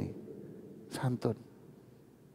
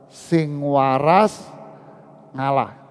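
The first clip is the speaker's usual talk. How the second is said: slow Javanese proverb; 3 dB louder, much slower than usual. Shown as a demonstration. Preacher quoting a Javanese proverb to the congregation, drawn out slowly. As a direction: stretch out sing waras very slowly, rising on waras. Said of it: "Apa kata orang Jawa?".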